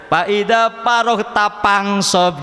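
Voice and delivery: Sundanese accent, slow, "paroh" with p instead of f; drawn out slowly in Sundanese accent, "Faida paroh" with p for f. Shown as a demonstration. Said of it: "orang Sunda katanya".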